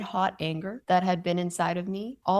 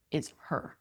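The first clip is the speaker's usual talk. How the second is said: whispered, much softer "is her"; 4 semitones lower, 10 dB quieter than usual. Real, not acted. Emphatic disgust at mother.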